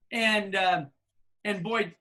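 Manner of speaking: usual speaking voice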